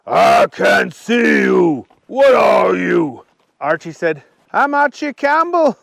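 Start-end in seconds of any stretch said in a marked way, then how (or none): 0.1-3.2 loud, slow dragon voice; bewildered dragon. A narrator's reading. Dragon calls to unseen Archie. 4.5-5.8 high-pitched character voice; Archie introducing himself. A narrator's reading. Archie answers the dragon.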